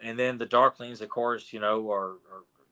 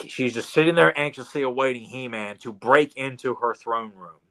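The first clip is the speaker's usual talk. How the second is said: normal talking voice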